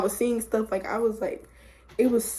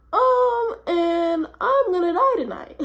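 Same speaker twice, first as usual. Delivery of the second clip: high-pitched panicked whine, played up; mock panic; 8 semitones higher, 3 dB louder, slower than usual. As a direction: wail it higher and louder, half joking. Retold panic while high.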